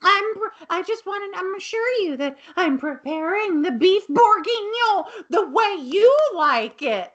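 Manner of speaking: high, eager, nervous voice; flustered Mrs Garrett. Retold or imitated. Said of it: "she goes".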